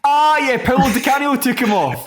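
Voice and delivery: a sudden high, loud burst of recall; light up as the memory hits; yelping out the name he just remembered; excited recognition. Real, not acted.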